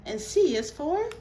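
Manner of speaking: loud, slow letter sounds. Real, not acted.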